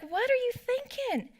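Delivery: can you believe this driver; incredulous exasperation. Retold or imitated. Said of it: "I was like".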